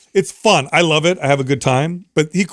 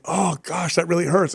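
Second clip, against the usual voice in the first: softer, higher fake moan of pain; mock groaning; 4 semitones higher, 5 dB quieter than usual.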